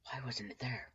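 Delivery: whisper. Acted. Panicked inner thought.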